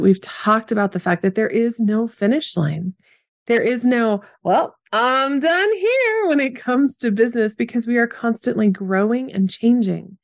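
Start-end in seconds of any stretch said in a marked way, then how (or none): 4.4-6.3 high, drawn-out mock announcement; mock declaration. Imagined business owner declaring it finished, mock-singsong. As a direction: stretch it out high, as someone signing off.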